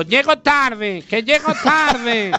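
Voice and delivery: high, drawn-out comic wail of panic; Cañita Brava. Retold or imitated. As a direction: imitate a frantic entertainer, stretching each word high. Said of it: "Cañita es muy imitable, a mí me encanta imitarlo". (imitación cómica, aguda y alargada, gritando apurado)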